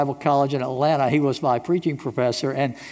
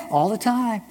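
normal talking voice

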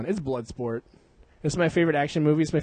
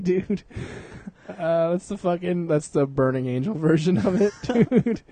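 normal talking voice

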